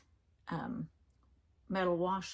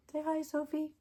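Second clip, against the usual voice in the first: high pet talk; 9 semitones higher than usual. Real, not acted. Introducing her pet to camera.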